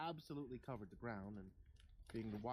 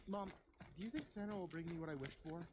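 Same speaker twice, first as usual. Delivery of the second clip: put-on pestering child; 8 semitones higher than usual. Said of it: "I pestered her constantly".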